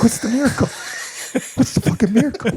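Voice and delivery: real laugh breaking through the question; high laughing disbelief at the card. Real, not acted.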